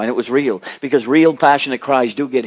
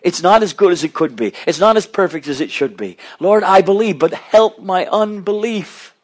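raised, pleading cry to the Lord; 6 semitones higher than usual. Retold or imitated. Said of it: "this man cried out".

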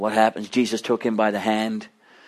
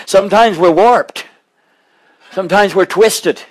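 high, loud, driving sermon voice; a pastor urging his flock with rising fervor; impassioned preaching; 6 semitones higher, 5 dB louder than usual. Acted. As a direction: preach it hard and pleading to the congregation. Preaching at full force.

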